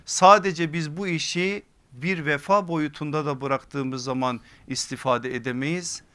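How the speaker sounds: ordinary talk, nothing marked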